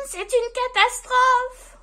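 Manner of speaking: high, loud wail of dismay; dismayed elf. A narrator's reading. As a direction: cry out like a panicking little elf. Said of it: "soupire le lutin".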